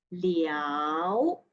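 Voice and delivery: "liao" rising, second tone. Shown as a demonstration. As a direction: bring "liao" up. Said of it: "Line number sixteen".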